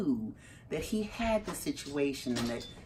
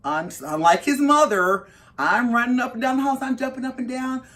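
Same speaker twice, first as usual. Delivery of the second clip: louder, higher, worked-up retelling; frantic excitement; 6 semitones higher, 7 dB louder than usual. Retold or imitated. Mother unlike her calm son, wildly excited. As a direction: gush it, beside yourself with joy. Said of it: "he was able to be calm".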